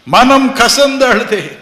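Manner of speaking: loud, high, slow bitter cry; loud slow lament; bitter weeping cry. Retold or imitated. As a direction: wail the bitterness out slowly.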